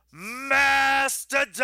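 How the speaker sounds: drawn-out, high-pitched bellow; mock bellow. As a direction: stretch every syllable out high and long. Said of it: "thrusts this meaty finger at his face and goes".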